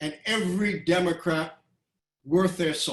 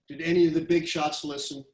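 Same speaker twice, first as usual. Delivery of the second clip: ordinary talk, nothing marked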